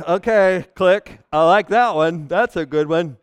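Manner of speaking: lightly voiced, loud lazy consumer; a hint of a couch critic; lazy churchgoer. Mimicking passive churchgoer.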